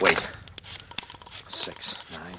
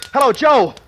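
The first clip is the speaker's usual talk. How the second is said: much higher and louder eager cry; much higher, louder eager cry into the phone; desperate hope; 9 dB louder than usual. Acted. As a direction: cry out, desperate for Joe to answer. Grabbing phone, hoping it's Joe.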